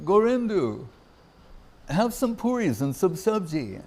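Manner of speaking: a lightly voiced elder urging a friend to eat; imitating Swami Maharaj. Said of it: "And Swami Maharaj would say".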